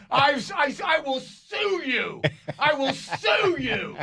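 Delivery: high, slow hysterical squeal; retold shrieking fury; mock enraged threat. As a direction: stretch each word in comic outrage. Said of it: "I was so mad at them".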